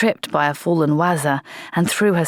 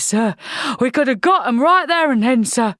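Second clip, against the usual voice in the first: higher, heated complaint; angry protest; 7 semitones higher than usual. Acted. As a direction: vent at the officer. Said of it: "quivering with anger".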